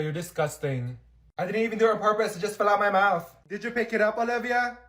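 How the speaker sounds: ordinary delivery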